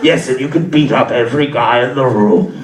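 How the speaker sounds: very low, rasping Star Wars emperor; evil emperor voice. Retold or imitated. Inner voice on cocaine as the evil emperor, deep and menacing. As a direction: growl it like a sinister old emperor. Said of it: "that evil emperor guy".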